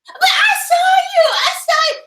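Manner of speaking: hammed-up pleading girlfriend; hysterical woman.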